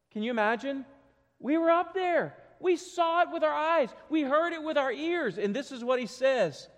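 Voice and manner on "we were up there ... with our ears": high-pitched, amazed testimony, acted out; excited eyewitness. Peter telling what he witnessed on the mountain, excited. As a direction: voice Peter in high, insistent wonder.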